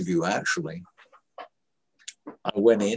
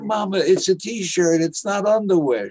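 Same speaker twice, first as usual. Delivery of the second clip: high-pitched protest to mother; protesting son; 10 semitones higher, 4 dB louder than usual. Retold or imitated. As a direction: argue back, exasperated, in a retold quarrel. Son arguing with mother, retold.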